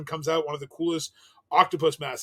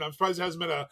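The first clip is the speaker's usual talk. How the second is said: ordinary talk, nothing marked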